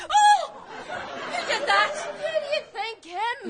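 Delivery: shocked mother's cry. Acted. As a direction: gasp out in disbelief. Shocked discovery.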